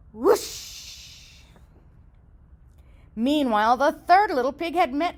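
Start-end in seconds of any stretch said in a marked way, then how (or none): 0.1-1.4 whoosh. Acted. House blown down.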